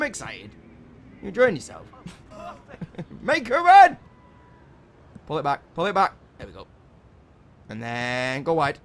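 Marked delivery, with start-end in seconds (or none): 3.2-4.0 shout. Real, not acted. Yelling at player.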